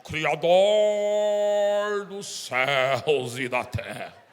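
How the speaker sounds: mock-solemn, booming prayer voice, very slow; hammed-up holy voice; changed praying voice. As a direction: drag out each word loudly like a pompous intercessor. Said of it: "Ele muda o tom de voz".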